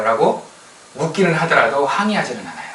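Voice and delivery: ordinary delivery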